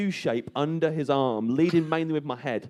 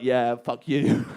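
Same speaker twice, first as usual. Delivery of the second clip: defiant taunt; 2 semitones lower, 3 dB louder, much slower than usual. Retold or imitated. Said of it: "just arms splayed like".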